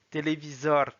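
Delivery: "Téléviseur" as Russian "televizor"; Russian pronunciation. Shown as a demonstration. Teacher reading words with learners, "Téléviseur" in Russian. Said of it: "s'entraîner à lire les mots".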